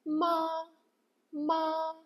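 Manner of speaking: Ma held high and level; first tone flat. Shown as a demonstration. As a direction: keep ma flat and steady, high pitch. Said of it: "start with the first one, which sounds flat".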